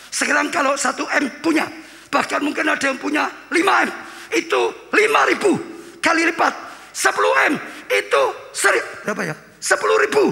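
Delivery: high, shouted sermon climax; preaching at full force. Acted. Pastor to congregation, shouting sermon climax.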